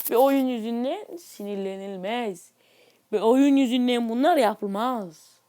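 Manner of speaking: slow, soft, preachy PSA read; public service announcement. Pretend. Youtuber lecturing viewers in a mock public-service tone, slow and soft. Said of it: "Kamu spotu verdiğimize göre".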